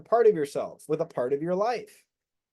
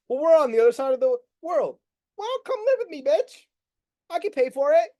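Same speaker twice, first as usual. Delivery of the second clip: high sassy mock voices; 10 semitones higher than usual. Acting out twin flame texting.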